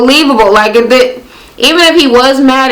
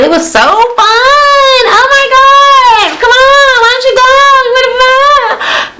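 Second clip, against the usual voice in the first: very high, drawn-out gushing; mock excited squealing; 13 semitones higher, slower than usual. Girls just off the ride to friends, mock excited squealing. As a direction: squeal it like hyped-up girls, stretching the words.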